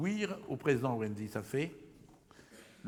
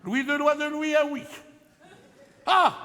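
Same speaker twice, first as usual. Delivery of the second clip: exclaimed wordplay; 10 semitones higher, 8 dB louder than usual. Acted.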